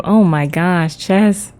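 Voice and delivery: ordinary delivery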